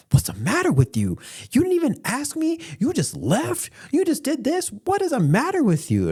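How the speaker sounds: high-pitched mock-mom outrage; scolding mom. Mom berating son for leaving, high hammed-up scolding. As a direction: scold in a shrieky imitated mother's falsetto. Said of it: "you know, typical mom".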